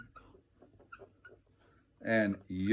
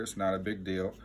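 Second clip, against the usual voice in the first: ordinary talk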